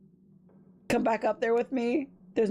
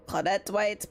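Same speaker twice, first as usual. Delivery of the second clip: ordinary delivery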